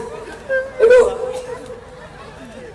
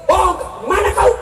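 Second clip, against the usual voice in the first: accusing wife; 7 semitones higher than usual. Retold or imitated. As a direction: snap back at a lying husband. Wife accusing husband on phone.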